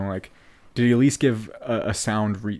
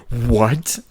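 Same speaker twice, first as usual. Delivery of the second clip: shocked; 7 dB louder than usual. Retold or imitated. Retelling both boys' shocked reaction.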